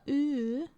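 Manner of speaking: "oo" as a long Norwegian-style vowel; "oo" held long with a foreign colour. Shown as a demonstration. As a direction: demonstrate a drawn-out Norwegian "oo". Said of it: "They go, like, more like of a".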